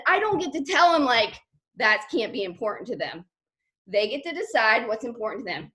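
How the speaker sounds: ordinary talk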